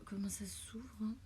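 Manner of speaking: whispered to herself; breathy whisper while fiddling. Real, not acted. Struggling to open package.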